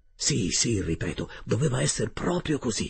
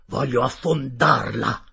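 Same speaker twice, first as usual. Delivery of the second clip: louder, slow, weighted declaration; grim resolve; 6 dB louder, slower than usual. Acted. Captain announcing he will sink the ship, grimly resolved. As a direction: state the threat slowly and forcefully.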